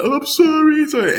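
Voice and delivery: high-pitched tearful apology, hammed-up; mock crying. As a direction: imitate a crying kid begging forgiveness. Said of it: "I started crying".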